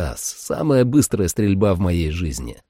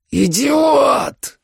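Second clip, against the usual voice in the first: high roaring vampire shout; 4 dB louder than usual. A narrator's reading. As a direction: roar it out as a furious vampire. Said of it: "взревел вампир".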